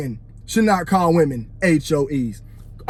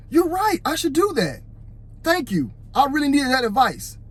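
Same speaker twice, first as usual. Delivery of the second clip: higher, overly sweet mock gratitude; fake sarcastic; 6 semitones higher than usual. Shown as a demonstration. To people giving him advice, fake sarcastic thanks. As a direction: thank him like you don't mean a word of it. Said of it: "I try not to sound as fake as I just did".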